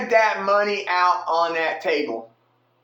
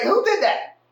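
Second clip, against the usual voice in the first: much higher, pointed accusing question; angry demand; 12 semitones higher than usual. Retold or imitated. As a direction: call out the guilty one sharply. Gargoyle repeats the demand.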